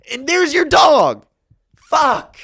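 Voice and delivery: high, loud, choked-up exclamation; overwhelmed. Real, not acted. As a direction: voice climbing with emotion. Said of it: "I'm about to cry right now".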